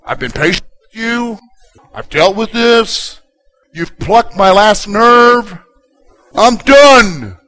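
high, slow fed-up build to a loud finish; mounting end-of-patience rant; acted exasperation. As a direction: count off grievances, then explode. Acts out what fed-up people say.

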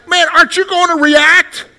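loud, high, impatient demand; exasperated outburst. Retold or imitated. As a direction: snap at the silent listener for a reaction. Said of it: "And then you're like".

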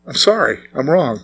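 a soft, humbled apology; soft confession. Retold or imitated. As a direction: own up quietly to God. Confessing to God in prayer.

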